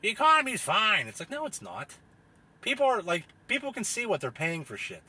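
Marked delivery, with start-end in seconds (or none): 0.0-1.0 high, slow, smug reassurance; sarcastic high TV-talking-head voice; mocking TV pundit. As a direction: parody a pundit insisting all is fine. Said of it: "some fucking dickhead on T V like".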